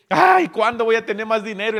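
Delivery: high, loud complaining sigh; mock wistful grumble; money-worried person. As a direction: whine about money. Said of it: "Hay gente que te dice".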